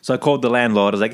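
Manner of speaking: usual speaking voice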